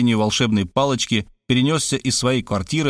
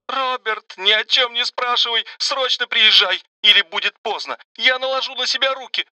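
high frantic hysterical cry; hysterical screaming; 11 semitones higher than usual. Acted. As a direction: scream it in panic. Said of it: "Мария истерично закричала".

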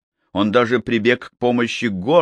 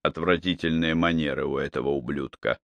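softer and lower, almost to himself; softly; 6 semitones lower, 7 dB quieter than usual. Acted. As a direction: say it softly, coldly. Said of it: "мягко произнёс Малкольм".

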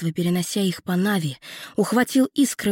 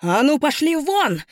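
high, loud yell; loud shout; 8 semitones higher, 4 dB louder than usual. A narrator's reading. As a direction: drive them off with a shout. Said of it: "вдруг громко закричала Лютая".

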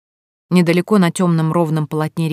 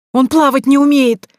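much higher, loud shriek; panicked shriek; 8 semitones higher, 6 dB louder than usual. A narrator's reading. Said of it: "взвизгнула Фая".

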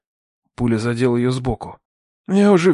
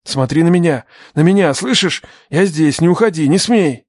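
raised, pressing plea; desperate urging; 3 semitones higher, 4 dB louder than usual. Acted. Man shakes the fading girl, desperate urging. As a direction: urge her desperately to stay.

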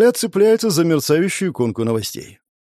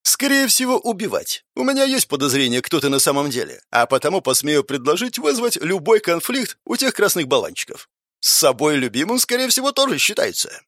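put-on mischievous teen voice; 3 semitones higher than usual. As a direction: tease like a cheeky teenager.

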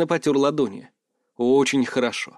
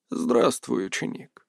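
put-on creaky old seer's voice; 6 dB quieter, slower than usual. A narrator's reading. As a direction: rasp out a greeting with creaky voice. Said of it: "скрипучим голосом бросила провидица".

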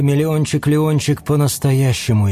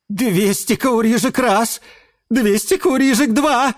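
rising, higher and higher auction close; auctioneer finale; 12 semitones higher than usual. A narrator's reading. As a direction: build to the final sale. Auctioneer closing the sale.

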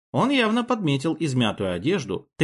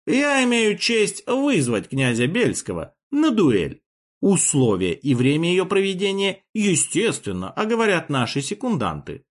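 higher, slow grand declaration; pompous tone; 7 semitones higher than usual. Acted. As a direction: proclaim it like solemn oratory. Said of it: "пафосным тоном заявил".

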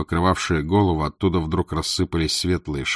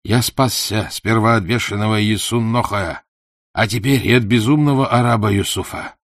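higher, relieved exclamation from the dark; exclaiming; 5 semitones higher, 4 dB louder than usual. A narrator's reading. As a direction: cry out in gleeful relief. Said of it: "воскликнул".

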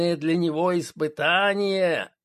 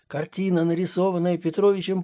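plain reading voice

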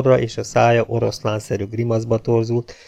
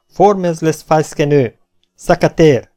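loud, high parade-ground order; sharp officer's command; 5 semitones higher, 6 dB louder than usual. A narrator's reading. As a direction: call out military commands to the whole troop. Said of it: "éles hangja megállást vezényelt".

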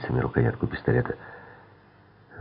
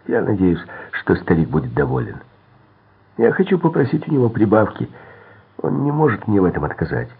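higher-pitched, cocky chatter; pitched-up, self-assured voice; regained confidence; 6 semitones higher than usual. A narrator's reading. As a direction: chatter on, sure of yourself. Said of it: "вернулась часть его уверенности".

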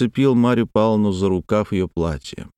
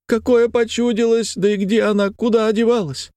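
very high wailing lament; keen through the tale as if weeping; high plaintive sing-out of grief; tearful woman; 14 semitones higher than usual. A narrator's reading. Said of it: "слезливым голосом".

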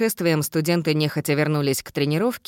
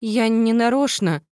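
frightened plea; 6 semitones higher than usual. Acted. Frightened girl pleads.

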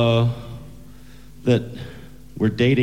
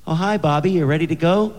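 put-on higher, sweet girl greeting; lightly voiced teenage girl; 7 semitones higher than usual. Girlfriend greets her date on the steps, light girlish voice. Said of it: "then Betty Sue comes down the steps".

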